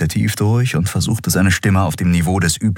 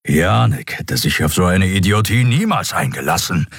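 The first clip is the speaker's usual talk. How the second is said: ordinary delivery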